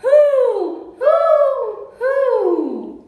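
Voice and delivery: high, loud falling hoots; three long, high owl calls; owl hooting. A narrator's reading. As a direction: hoot like an owl. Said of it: "The owl says".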